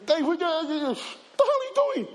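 hammed-up friends yelling at crying Dave; mates barging in. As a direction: burst in on your crying friend. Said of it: "Boys coming through".

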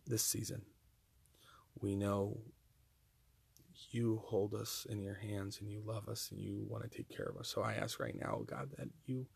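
soft, quiet earnest prayer voice; closing prayer. Acted. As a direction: pray gently to close the season.

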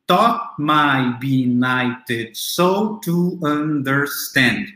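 very slow, each syllable set apart; measured, drawn-out scansion of a verse line; counting syllables. Shown as a demonstration. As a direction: count out "Taught my benighted soul to understand" syllable by syllable. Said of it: "Eu posso contar aqui".

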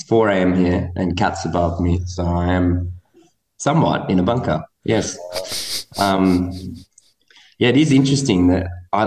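echoey concrete room sound; loud and boomy in a bunker; inside concrete bunker. Real, not acted. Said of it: "it sounds like you're in a bunker".

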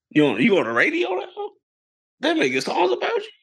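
retold questioning, climbing high; mock incredulous. Boyfriend quizzing girlfriend about the song, mock incredulous.

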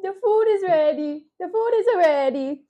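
high, lilting mock-Italian mamma; Italian mother. Mother calling her children in for dinner, mock Italian accent. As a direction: call out in a hammed-up Italian accent. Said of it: "Be like an Italian mother".

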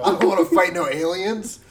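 cowering plea. Retold or imitated. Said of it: "that's Danny's frightened voice".

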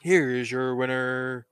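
slow, stretched winner announcement; ring announcer. Acted. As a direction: draw out the winner's name.

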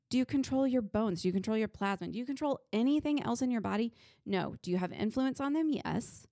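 ordinary delivery

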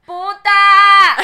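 sudden loud high shout of the insult; retold yell. Yelling an insult at a woman arriving at church. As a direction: hurl it across the churchyard at full voice. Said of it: "y yo le grité".

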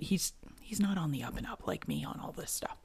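whispered aside, unvoiced. Real, not acted. Podcaster whispers a teasing aside about her husband, hushed. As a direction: confide it in a hush to the listeners.

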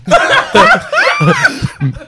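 high cracking laugh; laughing at himself; burst of laughter. Real, not acted. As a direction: giggle breaking the accent. Laughing at own accent attempt.

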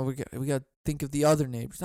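ordinary talk

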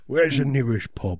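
put-on English, echoing a pal; mock English accent. Tourist asking locals for directions, mock English accent. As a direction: copy the bad English accent. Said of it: "Just do the accent".

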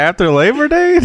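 mock shocked. Real, not acted. Mock outrage over beige suit.

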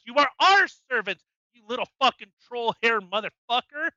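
emphatic rant, punching each phrase; indignant telling-off with hard-hit words; angry yelling. Real, not acted. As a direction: lay down the law to a useless representative. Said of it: "I'm yelling".

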